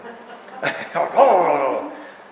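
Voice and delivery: loud self-amused laugh; real laugh. Real, not acted. Laughs after calling himself dramatic.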